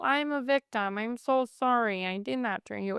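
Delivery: a hint of a limp, robotic victim. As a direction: recite it like a powerless robot. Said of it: "It's a new voice".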